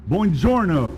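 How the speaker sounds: a proud learner's buongiorno; Italian greeting. Shown as a demonstration. Speaker greets the room in Italian, proudly.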